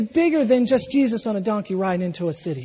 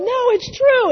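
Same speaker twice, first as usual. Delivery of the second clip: much higher, excitedly insisting; eager insistence; 5 dB louder than usual. Retold or imitated. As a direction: eagerly vouch for it. Disciples backing up the healed man.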